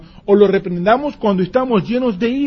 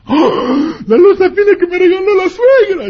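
much higher, louder wailing cry; anguished shriek; 13 semitones higher, 8 dB louder than usual. Retold or imitated. Spouse wailing over the mother-in-law's broken dish, shrill.

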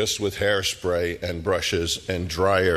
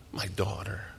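much softer, almost breathed away; soft, breaking-down murmur; 9 dB quieter than usual. Retold or imitated. Jairus aching for his dying daughter, hushed murmur. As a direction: let it fall to a hush. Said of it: "That's what he's thinking".

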